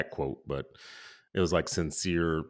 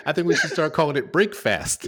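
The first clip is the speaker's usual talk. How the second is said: higher and louder, laughing through it; joking suggestion said laughing; 9 semitones higher, 8 dB louder than usual. Real, not acted. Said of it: "I think you should go the other way".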